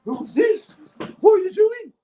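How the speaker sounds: very high, strained put-on voice climbing with each question; mock bewildered squeal. Retelling first ketamine trip on the phone, mock bewildered squeal. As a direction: squeal it in escalating confusion, hammed-up. Acting out confused reaction on ketamine.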